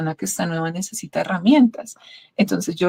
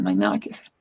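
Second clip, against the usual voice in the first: much lower, a solemn medical sentence; grave doctor's verdict; 11 semitones lower, 6 dB quieter, much faster than usual. Retold or imitated. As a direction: drop the voice low like a doctor giving bad news. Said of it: "Y ellos decían".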